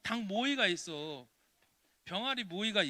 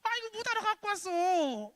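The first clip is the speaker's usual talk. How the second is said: high, loud mock scolding mother; 7 dB louder than usual. Children's sermon, voicing the mother scolding, high mock nag. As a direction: play the nagging mom, pitched way up.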